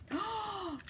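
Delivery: soft, sharp intake of breath; story gasp. Acted. Reader before the rescue arrives, soft gasp. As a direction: gasp in suspense.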